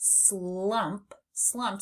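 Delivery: "slump" said very slowly; drawn-out slump. Shown as a demonstration. Teacher reads the blue word slowly. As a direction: drag out "slump". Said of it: "it says slump".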